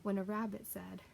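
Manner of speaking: usual speaking voice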